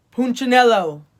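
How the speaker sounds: loud booming call of the name; deep strong call. A narrator's reading. Eli calls Punchinello back, loud and strong. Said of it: "The voice was deep and strong".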